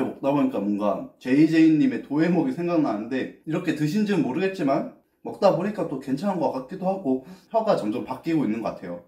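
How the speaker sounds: between spoonfuls of ice cream; casual eating chat; talking while eating ice cream. Real, not acted. Seen 1: holds cup and spoon while talking; bites between, mouth unclear.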